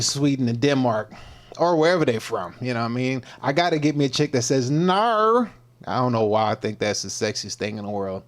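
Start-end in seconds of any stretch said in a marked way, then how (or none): 4.7-5.5 "Nar." said high and drawn out, a Scandinavian-sounding no; teasing imitation of a foreign "Nar" for no; Nordic "nar" for no. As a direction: mimic a Swedish girl's "Nar". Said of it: "N A R is how they say N O".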